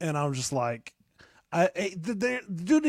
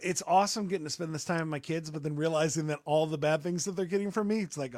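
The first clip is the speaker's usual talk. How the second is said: ordinary delivery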